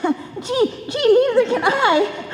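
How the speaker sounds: ordinary delivery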